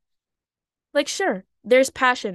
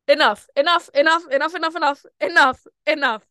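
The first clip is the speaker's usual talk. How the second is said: louder repeated chant of enough; exasperated; 4 semitones higher, 5 dB louder than usual. Pretend. Scolding her past self, loud chanted exasperation. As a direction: halt it in mock exasperation.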